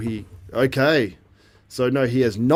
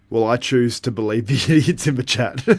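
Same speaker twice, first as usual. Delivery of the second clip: teasing, laughter bursting in mid-sentence; breaking into laughter; 2 semitones higher, 5 dB louder than usual. Real, not acted. Streamer teasing the live chat, breaking into laughter. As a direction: start straight, then crack up laughing.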